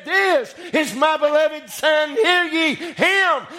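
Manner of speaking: high, drawn-out preacher's proclamation; booming voice of God. Acted. God proclaims his Son from heaven, booming. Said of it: "God boomed out of heaven and said".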